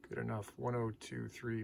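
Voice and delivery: usual speaking voice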